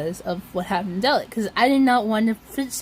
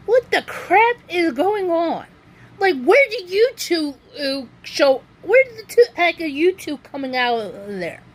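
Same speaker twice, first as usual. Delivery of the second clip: higher-pitched incredulous questioning; retold bafflement; 6 semitones higher than usual. Shopper questioning two ladies from nowhere, high retold bafflement. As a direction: demand an explanation in a high, puzzled retelling. Retelling her baffled reaction.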